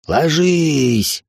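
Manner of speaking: high, drawn-out cry; shouted warning. A narrator's reading. Warning companions as the guns take aim, shouted command. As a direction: stretch the command out as a yell. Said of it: "крикнул я".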